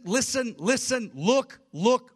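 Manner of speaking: LISTEN and LOOK each punched high and loud; urgent exhortation. Acted. Preacher urging people not to give in to fear, urgent exhortation.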